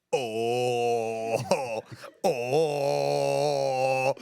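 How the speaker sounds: a drawn-out imitated groan of nausea; mock groan. Nigel on his backpack, mock queasy groaning. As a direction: moan like you're holding down sick. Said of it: "Nigel in the corner going".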